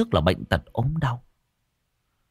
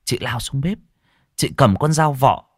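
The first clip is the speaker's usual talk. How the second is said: ordinary delivery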